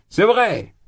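insist, almost shouting; excited cry. Acted. Drunk young man pressing the ladies, crying out. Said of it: "criait Razumikine".

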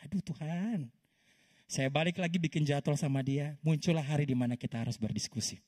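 normal talking voice

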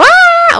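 very high, loud scream; demo scream. Shown as a demonstration. Player testing whether the game needs screaming, high scream. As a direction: let out a sudden test scream. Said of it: "do I actually have to scream".

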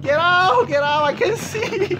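high, loud cries broken by laughter; laughing panic. Real, not acted. Player swarmed by puppies, shrieking with laughter.

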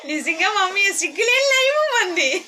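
high, loud cry-laugh, voice cracking; choked up, half laughing half sobbing; laughing through tears. Real, not acted. Thanking uncle live on stream, laughing through real tears.

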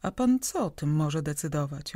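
ordinary delivery

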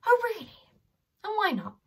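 mock surprise.

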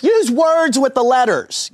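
very high, mock Indonesian voice; bad Indonesian accent. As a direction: imitate an Indonesian call-center woman, high-pitched. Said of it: "forgive me for this bad Indonesian accent".

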